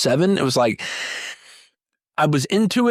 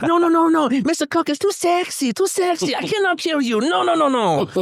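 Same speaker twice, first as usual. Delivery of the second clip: Banderas impression. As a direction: ham up the Latin lover falsetto. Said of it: "that's what his face looked like to me".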